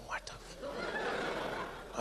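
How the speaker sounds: tiny high, breathed exclamation; baffled Indian man. Retold or imitated. Audience member quietly reacts to the joke, hushed bafflement. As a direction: trail it out very softly, high and slow. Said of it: "Indian guy's going".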